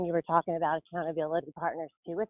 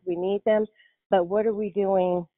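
ordinary delivery

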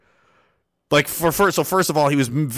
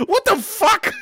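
outraged shout; slower than usual. Retold or imitated. Soldier yells at the doctor who called him a baby, indignant shout. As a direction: shout it in disbelief.

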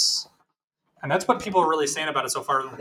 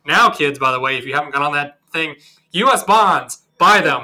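loud mock advice; 8 dB louder than usual.

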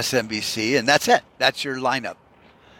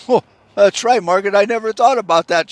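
higher, louder hammed-up news anchor delight; mock anchorman; 8 semitones higher, 7 dB louder than usual. Bob answering Margaret on air, hammed-up cheery anchorman.

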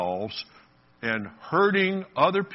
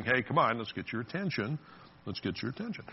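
softly voiced nudge; gentle coaxing; 7 dB quieter than usual. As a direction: coax quietly, dropping the volume further on the repeat. God nudging the believer, voiced.

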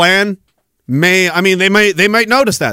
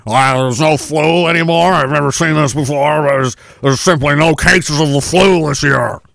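put-on official voice, a bit lower; hammed-up official announcement voice; mock provincial official; 4 semitones lower than usual. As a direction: play the baffled government spokesman. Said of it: "same province that said the flu".

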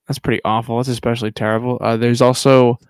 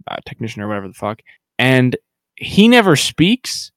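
normal talking voice

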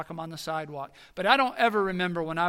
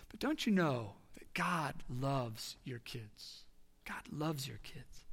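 tender reassurance; 4 semitones lower, 7 dB quieter, much slower than usual. Real, not acted. Tender reassurance to worried parents.

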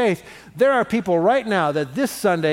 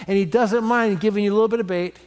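ordinary talk

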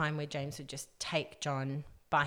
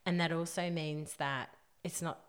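normal talking voice

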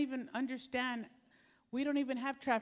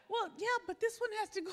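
driver voice; 9 semitones higher, faster than usual. Retold or imitated. Voicing the driver.

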